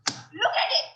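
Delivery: sudden forceful command; exasperated outburst; sharp demand. Acted. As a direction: burst out with impatience. Repeated demand, now snapped.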